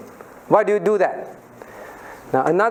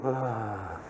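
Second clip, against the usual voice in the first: scornful huff; 10 semitones lower, 13 dB quieter than usual. Retold or imitated. Acts boys scoffing.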